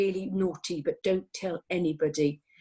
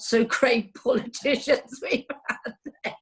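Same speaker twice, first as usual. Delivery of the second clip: higher voice breaking into laughter; laughing; 5 semitones higher than usual. Real, not acted. Speaker joking about politicians at Congress, laughing. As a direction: crack up partway through the phrase.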